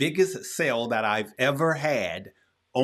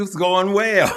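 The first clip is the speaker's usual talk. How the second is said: It's going well said through a real laugh, louder; 5 semitones higher, 4 dB louder than usual. Real, not acted. Host greeting his guest Tyler, laughing.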